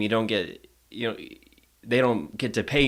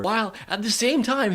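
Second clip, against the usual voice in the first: ordinary talk, nothing marked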